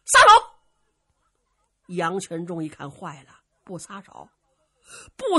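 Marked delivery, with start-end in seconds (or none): 0.1-0.5 very high, very loud command; battle shout. Acted. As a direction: bark it as the spear strikes. Luo Cheng's battle cry.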